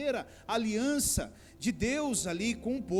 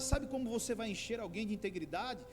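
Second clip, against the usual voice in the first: usual speaking voice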